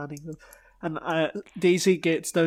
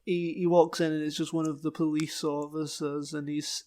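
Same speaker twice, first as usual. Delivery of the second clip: ordinary talk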